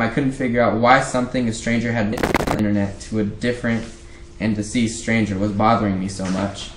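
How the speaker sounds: ordinary delivery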